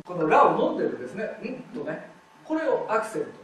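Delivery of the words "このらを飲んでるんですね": usual speaking voice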